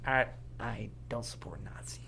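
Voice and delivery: breathy whisper as the Joker, coolly understated; quiet menacing Joker voice; Heath Ledger Joker. Retold or imitated. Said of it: "good impression".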